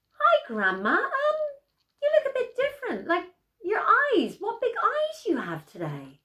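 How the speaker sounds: put-on high, light child's voice; a small girl, confused and curious; puzzled little girl. Girl greets the disguised wolf, high and puzzled.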